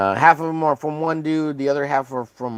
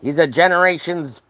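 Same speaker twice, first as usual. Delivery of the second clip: higher, hammed-up Australian accent; Steve Irwin; 5 semitones higher than usual. Croc hunter asking daughter for help with alligator, mock Australian accent. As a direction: play an excitable Aussie croc hunter. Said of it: "Don't disparage Steve Irwin. He's a, he's an Australian icon".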